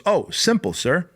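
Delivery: light, high and breezy; cheerful simple. Retold or imitated. Trainee explaining the bus trip to his boss, breezy and high. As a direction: toss it off as if it were easy. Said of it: "He goes".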